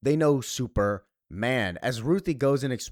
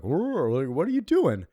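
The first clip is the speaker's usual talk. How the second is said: mock-startled, jumping high in pitch; startled protest; 5 semitones higher, slower than usual. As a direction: act taken aback, voice leaping up. Acted reaction to being pushed off.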